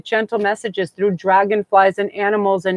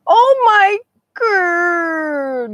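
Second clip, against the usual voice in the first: overcome gasp; 10 semitones higher, 3 dB louder, much slower than usual. Real, not acted. Overcome reacting to spirit card.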